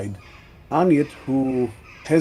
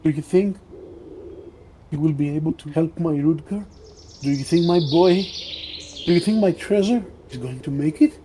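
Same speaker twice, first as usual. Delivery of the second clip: higher, pleading mother; worn-out mother pleading, pitched up; soft, broken voice; 3 semitones higher, faster than usual. Acted. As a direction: ask anxiously about your sick boy, higher. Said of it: "she speaks in a soft voice, revealing how tired and broken".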